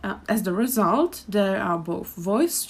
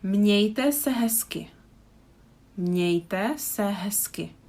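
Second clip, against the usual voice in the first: Mějte se hezky said slowly, mě as mňe; slow model phrase; slower than usual. Shown as a demonstration. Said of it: "teacher model phrase".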